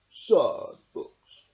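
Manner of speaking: plain reading voice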